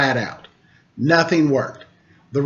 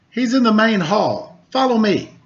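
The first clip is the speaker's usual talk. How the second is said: lord's voice pitched up, eager; the lord hurries the samurai cat to the rat, higher and eager; 6 semitones higher than usual. Acted. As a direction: urge the cat along, keen to help. Dayamo voiced leading the cat.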